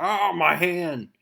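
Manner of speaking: high, loud, slow cry of pain. Retold or imitated. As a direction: wail as if the burning hand hurts. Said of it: "And he's like".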